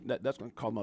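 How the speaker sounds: ordinary delivery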